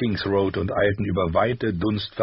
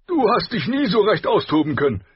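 loud, raised-pitch grumble; grumbling king; 12 semitones higher, 5 dB louder than usual. A narrator's reading. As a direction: complain heartily as a blustering king. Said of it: "brummte Robert". (lautstark brummelnder König)

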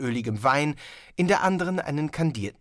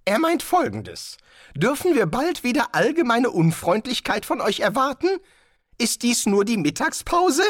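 higher and louder, biting sarcasm; sarcastic wizard; 11 semitones higher, 5 dB louder than usual. Acted. Rincewind mocking the guest master, higher and sarcastic. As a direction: needle the host with mock politeness.

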